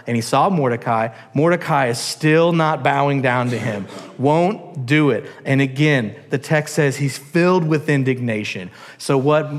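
usual speaking voice

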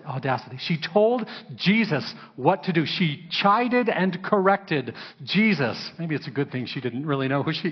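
usual speaking voice

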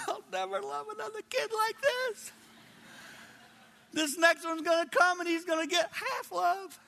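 high-pitched tearful wail, acted out; retold crying. Father fearing for his second child's share, mock crying. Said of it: "And I remember crying, going".

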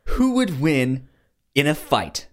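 normal talking voice

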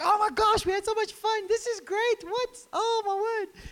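high squealy mock gush; gushing partygoers. Preacher mimicking friends gushing after the party, high and squealy.

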